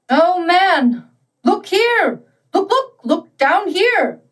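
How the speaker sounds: high, loud, pleading cry; urgent exclamation. A narrator's reading. The ghost crying out to Scrooge to see the children. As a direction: force him to look down, rising to a cry. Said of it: "exclaimed the ghost".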